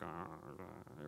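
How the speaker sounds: low, quiet hesitant grunt; low reluctant uh-uh; hesitant mumble. Retold or imitated. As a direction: mumble like you're avoiding the basket. Person dodging the offering basket.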